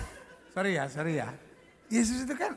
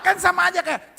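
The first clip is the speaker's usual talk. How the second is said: normal talking voice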